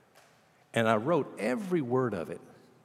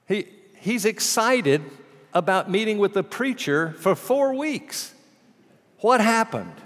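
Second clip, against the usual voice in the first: high, loud, slow and emphatic; preacher thrilled retelling the news, much higher and louder; excited build; 10 semitones higher, 7 dB louder, slower than usual. Real, not acted. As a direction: let the excitement burst out. Preacher's excitement telling the story.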